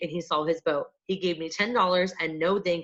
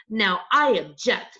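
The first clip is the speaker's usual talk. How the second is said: protesting cry; 3 semitones higher, 5 dB louder, slower than usual. A narrator's reading. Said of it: "Miss Lana cried".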